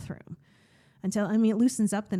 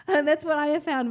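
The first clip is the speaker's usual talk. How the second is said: laughing; 7 semitones higher, 5 dB louder than usual. Real, not acted. Still laughing.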